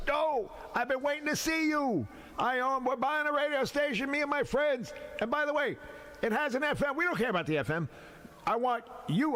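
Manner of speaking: pitched high and pressing, all in one rush; eager cousin's pitch. Retold or imitated. Cousin at the wedding begging Hank to take the job, high and eager. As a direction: sell it like you can't wait to hire him.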